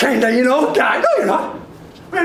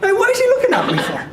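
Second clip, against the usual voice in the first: suspicious player's inner voice; 8 semitones higher, much faster than usual. Retold or imitated.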